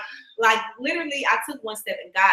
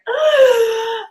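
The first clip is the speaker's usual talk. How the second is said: startled sob; 7 dB louder than usual. Retold or imitated. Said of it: "Like, bawling".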